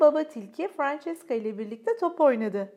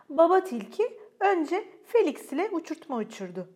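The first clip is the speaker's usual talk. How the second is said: usual speaking voice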